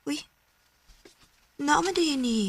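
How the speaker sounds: ordinary talk, nothing marked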